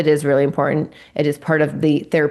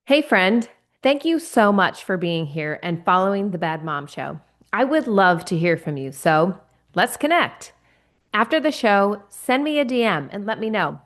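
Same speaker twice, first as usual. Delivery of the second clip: bright, higher host sign-off to listeners; podcast outro read; 4 semitones higher than usual. Acted. Host thanking listeners of The Bad Mom Show, upbeat outro read. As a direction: invite the audience warmly, as a show host.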